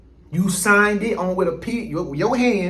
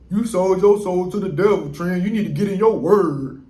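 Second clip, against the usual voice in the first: commenter quote. Retold or imitated. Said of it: "somebody said".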